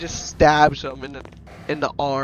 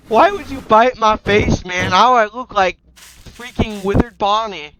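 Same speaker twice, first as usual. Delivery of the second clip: raised-pitch, loud griping; acted upset protest at the attacker; indignant complaint; 4 semitones higher, 5 dB louder than usual. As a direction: complain in play outrage. Bitten character complains to attacker.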